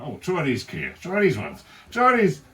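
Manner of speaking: high, excited fussing voice pressing glasses on someone; hammed-up eager stylist offering specs; high-pitched teasing offer of glasses; mock pushy adviser. Acted quote offering Starmer glasses.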